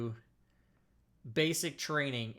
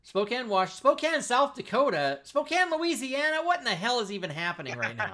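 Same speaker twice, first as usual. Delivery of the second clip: high, loud incredulous list; loud bafflement breaking toward laughter; mounting disbelief; 8 semitones higher, 5 dB louder than usual. Real, not acted. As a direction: read each one more amazed, climbing in pitch. Incredulously reads growing list of towns.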